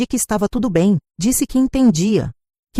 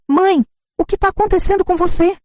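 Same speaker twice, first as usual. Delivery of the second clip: higher, incredulous daughter voice; indignant daughter; 11 semitones higher than usual. Acted. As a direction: protest in disbelief as the spoiled daughter. Daughter shocked at refusal.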